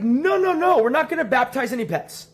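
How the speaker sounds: very high, loud mock shriek; hammed-up panic, voice leaping up and coming down a little; panicked refusal. Husband stopping wife baptizing pets, panicked refusal.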